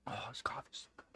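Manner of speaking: hushed whisper over the coffee. Real, not acted. Sipping coffee beside the cat, a whisper. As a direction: breathe it without voice.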